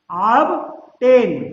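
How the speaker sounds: "ऑब्टेन" drawn out slowly with the b clearly sounded; "ऑब्टेन" said slowly, ob-ten; slow model word. Shown as a demonstration. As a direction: stretch "ऑब्टेन", b audible. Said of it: "demonstrating obtain".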